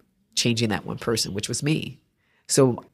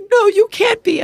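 much higher and louder, no, you're not; disbelieving cry; 7 dB louder than usual. Retold or imitated. The woman meets the artist, high loud disbelief. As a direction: shriek her happy disbelief. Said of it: "She's like".

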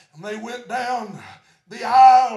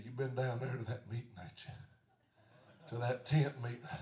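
put-on old butcher; 12 semitones lower, 14 dB quieter, faster than usual.

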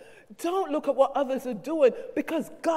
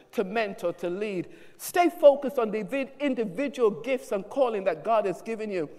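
measured pulpit preaching, urging focus; 3 semitones lower than usual. Acted. Pastor exhorting his congregation, measured preaching. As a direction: exhort the church to stay focused.

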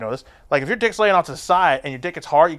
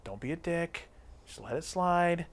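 much softer self-talk; quiet inner voice; 3 semitones higher, 8 dB quieter than usual. Retold or imitated. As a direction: talk yourself down under your breath. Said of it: "I'm like".

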